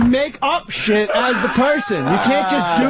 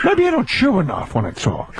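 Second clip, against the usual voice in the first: low-pitched film-star caricature; Al Pacino; 5 semitones lower than usual. Acted. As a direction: drop the pitch and chew through the words. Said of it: "When did Al Pacino become".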